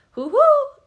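very high, loud sing-out greeting; called out. A narrator's reading. Oaken greets girls entering the trading post, calling out. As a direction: hoot a hello across the shop. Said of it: "called Oaken".

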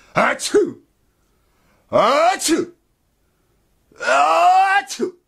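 three loud very high theatrical sneezes; hammed-up sneezing fit. A narrator's reading. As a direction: sneeze thrice, each bigger. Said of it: "E fece altri tre starnuti".

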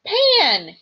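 high, loud indignant correction; indignant cry. Acted. Grover correcting Percy's mistake, loud and indignant. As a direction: cry the name out, offended. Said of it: "he cried indignantly".

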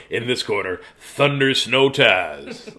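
slow, drawn-out ring announcer call; lightly voiced wrestling intro, very slow. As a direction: stretch the name out like a ring announcer. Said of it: "That's what Ginger wrote".

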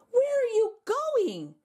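high, slow call after the wok; startled high cry. A narrator's reading. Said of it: "cried Mister Zhang".

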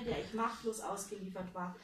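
ordinary delivery, nothing marked

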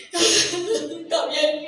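ordinary delivery, nothing marked

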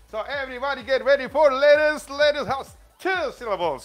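loud, high, excited announcement; big, high-energy teacher shout; rallying call. Real, not acted. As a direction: call the whole class to attention. Rallying the class for lettuce.

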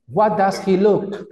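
deliberate classroom example; incorrect example. Shown as a demonstration. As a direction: hold up "What does he look?" as a sample error, word by word. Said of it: "Ah, es incorrecto".

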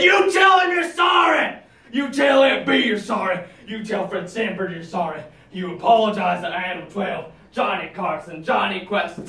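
raging shout, fading toward the end; furious shouting. Retold or imitated. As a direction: yell each name at her. Screaming apology demands.

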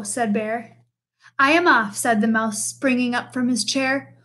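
put-on lively read-aloud for children; storybook reading. A narrator's reading. Teacher reading a picture book to the class, lively storytelling.

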